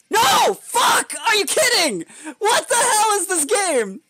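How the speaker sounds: high-pitched screaming gamer rage; outraged yell at the game; mad. Real, not acted. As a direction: explode in loud, shrieking disbelief. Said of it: "I'm so mad".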